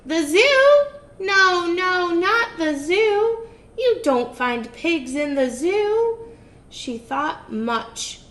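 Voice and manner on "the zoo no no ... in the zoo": high, loud, dismissive witch; scoffing witch. Acted. Witch scolding herself about the circus. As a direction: scoff at your own idea.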